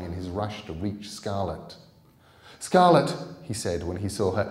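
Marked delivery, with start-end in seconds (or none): 2.6-3.1 put-on much higher, louder urgent boy; boy warning his friend. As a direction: alarm her with a panicked call.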